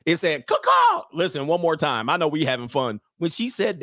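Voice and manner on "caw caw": short, very high, loud crow; mimicked two-note rooster call. Comic imitating the rooster she spoke over, crowing. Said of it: "Did you hear the rooster".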